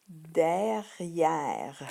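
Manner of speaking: "derrière" said clearly with the final E silent; model pronunciation. Shown as a demonstration. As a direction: end on the R, no "eux" sound. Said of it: "Donc, on dit".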